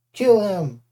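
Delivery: loud insistent command; shouted. Acted. Clarice insists Percy kill the Cyclops, loud shout. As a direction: demand the kill again, loud. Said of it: "Clarice shouted".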